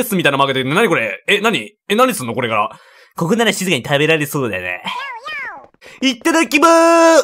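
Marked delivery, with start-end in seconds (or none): 6.0-7.2 higher, cheery little dino voice; sing-song Yoshi reading his lines. Acted. Player voicing Yoshi before a meal, cheerful and high.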